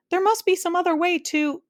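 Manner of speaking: high, loud pleading; urgent plea. A narrator's reading. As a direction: try to stop the fight. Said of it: "began Milo".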